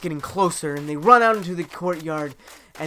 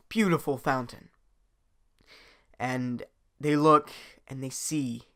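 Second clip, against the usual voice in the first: ordinary delivery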